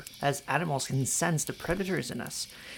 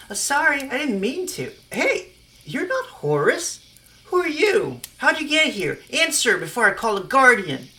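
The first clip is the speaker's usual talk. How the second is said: put-on high, loud startled girl voice; alarmed young girl, much higher and louder; startled teen girl; 9 semitones higher, 9 dB louder than usual. Stephanie startled, threatening Jane.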